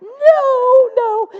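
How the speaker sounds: high, loud wail of no; yelling in dismay. Retold or imitated. Woman yelling to nobody as the light goes out, high loud dismay. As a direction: cry out in disbelief. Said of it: "she's yelling out loud to nobody".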